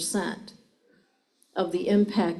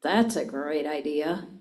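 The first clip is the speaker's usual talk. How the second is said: low, dry and sarcastic; 6 semitones lower than usual. Shown as a demonstration. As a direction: say it as if the idea is dumb. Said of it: "Or I can say".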